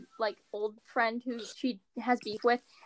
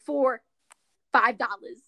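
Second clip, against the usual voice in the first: mock ad read; 5 dB louder than usual.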